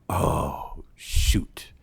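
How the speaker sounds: low, drawn-out "oh", whispered "shoot"; retold dismay. Provider hearing the drug is fentanyl, deflated. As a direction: sink into a mock-deflated reaction.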